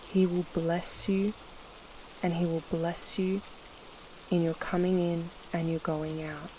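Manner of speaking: ordinary delivery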